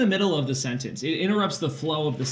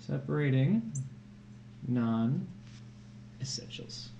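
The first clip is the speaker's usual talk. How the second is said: whisper; 2 semitones lower, 8 dB quieter, much slower than usual. Real, not acted. Whispers the lesson title.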